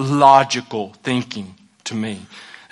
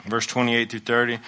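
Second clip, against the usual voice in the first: normal talking voice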